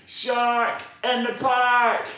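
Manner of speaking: loud, slow call of alarm; a boy's loud warning cry; shouting. Acted. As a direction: shout it out to warn everyone. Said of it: "He shouts".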